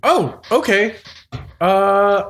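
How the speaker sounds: chirpy high crab pal; crab friend voice. Acted. [name] answers Kibe in character.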